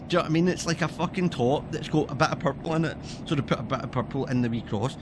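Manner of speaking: normal talking voice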